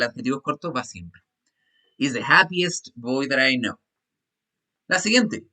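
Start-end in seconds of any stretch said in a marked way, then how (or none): 2.0-3.8 slow, careful dictation pace; teacher modelling an English sentence; slow model reading. Shown as a demonstration. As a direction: read it slowly for the class. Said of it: "teacher reads sentence to class".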